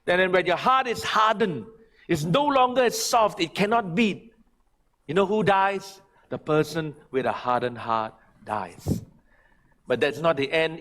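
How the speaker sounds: normal talking voice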